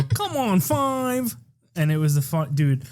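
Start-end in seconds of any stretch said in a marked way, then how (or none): 0.0-1.3 imitating coworker. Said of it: "And he would go".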